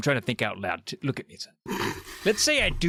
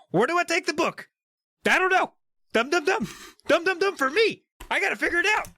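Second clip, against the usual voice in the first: much higher, hammed-up fretting author; R.L. Stine. Joking about the author stuck for an ending, high and fussy. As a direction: squeak it like a flustered writer stuck on the plot. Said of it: "R.L. Stine was like".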